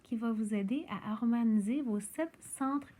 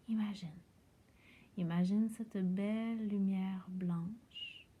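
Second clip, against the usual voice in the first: guided meditation. Acted. Yoga teacher guiding listeners lying on their backs into rest, guided meditation voice.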